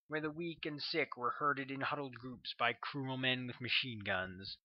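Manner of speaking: plain reading voice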